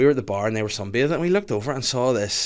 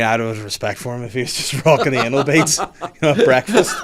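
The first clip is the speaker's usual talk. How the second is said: laughing; 5 dB louder than usual. Real, not acted. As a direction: laugh through the punchline. Laughing at own joke.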